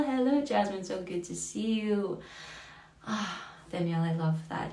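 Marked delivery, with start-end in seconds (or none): 0.0-0.8 high joyful greeting. Real, not acted. Host joyfully greets a viewer in chat, high sing-song. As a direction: sing the hello out with delight.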